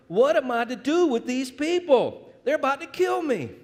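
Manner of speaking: high, loud desperate cry to God; Moses crying out. A narrator's reading. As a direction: plead up high like a man at his wits' end. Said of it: "Then Moses cries out to the Lord".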